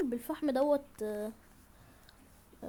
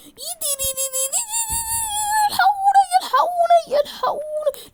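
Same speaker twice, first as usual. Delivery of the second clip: very high, louder, crying screams for help; screaming for help; 10 dB louder than usual. Real, not acted. Gamer falling into danger, screaming for help. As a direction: shriek in frantic despair. Drowning panic in game.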